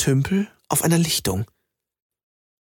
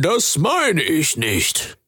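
high, slow scheming frog voice; a selfish frog justifying himself, slowly; sly frog creature; 6 semitones higher, much slower than usual. Acted. Frog creature explains to Jamie why he steals, high slow voice.

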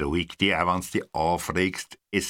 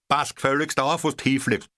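high, sharp telling-off; irritated scolding; snappy retort; 7 semitones higher than usual. A narrator's reading. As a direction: snap at the newcomer. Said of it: "retort from the dark".

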